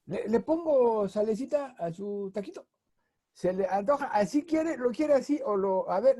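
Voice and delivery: high, coaxing street-vendor patter; a playful vendor pitch; imitating a taco vendor. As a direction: sell the taquito like a chatty vendor.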